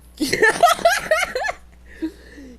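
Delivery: a short burst of high laughter; a high laugh at a friend's crash. Real, not acted.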